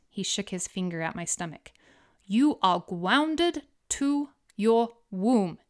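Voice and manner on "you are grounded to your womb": slow, goofy cartoon-hunter voice; Elmer Fudd voice. Husband scolding the unborn baby in a slow Elmer Fudd voice. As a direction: drag it out like a silly cartoon hunter. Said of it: "in his best Elmer Fudd accent".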